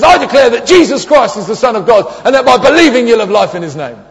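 loud ringing proclamation; bold declaration. Retold or imitated. Friend declaring boldly to the customs officer, loud and proud.

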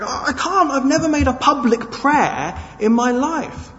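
high, loud frightened cry; panicked reply. Acted. John shouting back at his friend, panicked. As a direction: blurt it out in panic.

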